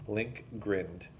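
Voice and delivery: ordinary delivery, nothing marked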